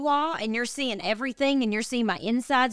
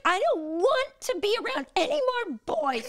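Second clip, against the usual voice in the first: put-on much higher, childish whine; whiny tantrum; 12 semitones higher than usual. Wife replaying her pregnant self to the nurse, high whining. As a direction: whine it like a sulky kid.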